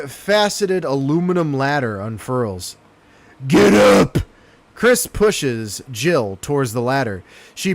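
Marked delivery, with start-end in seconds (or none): 3.5-4.2 loud urgent command; shouting. Acted. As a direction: yell it over the rotor noise. Character yells warning.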